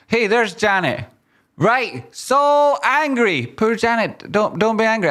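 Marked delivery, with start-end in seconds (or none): 1.6-5.1 mock sympathy. Teasing a chatter called Janet.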